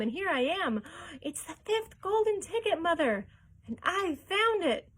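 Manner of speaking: high, loud, breathless with excitement; boy shows his mother the golden ticket, overjoyed; excited boy. A narrator's reading. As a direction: gush it all out in one thrilled rush.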